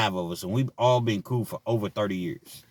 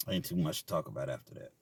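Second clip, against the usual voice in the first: much lower, much softer repeat; deadpan under-the-breath repeat; quiet aside; 9 semitones lower, 12 dB quieter, faster than usual. Shown as a demonstration. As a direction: drop it to a low muttered echo.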